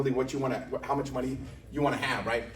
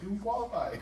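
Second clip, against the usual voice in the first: mom's voice; 6 semitones higher, 4 dB quieter than usual. Retold or imitated. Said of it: "My mom's like".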